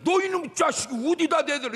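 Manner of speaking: high-pitched mock scolding; acted parental outburst. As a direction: tell off a defiant child. Voicing parent scolding defiant child.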